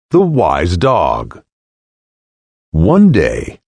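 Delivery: ordinary delivery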